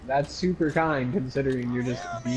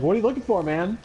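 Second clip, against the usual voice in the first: higher, louder mock call-out; 8 semitones higher, 4 dB louder than usual. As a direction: shout it across to the guy in the trash. Calling out to garbage diggers.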